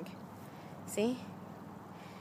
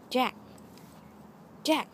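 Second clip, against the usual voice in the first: ordinary talk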